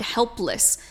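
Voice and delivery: ordinary delivery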